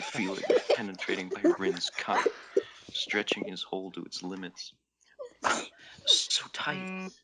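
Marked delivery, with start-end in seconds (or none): 6.1-7.1 growl. Acted. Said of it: "Rin growled".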